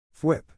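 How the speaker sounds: high, loud thwip sound effect; web shooter thwip. Acted. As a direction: snap out the web noise.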